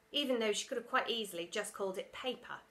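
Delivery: ordinary delivery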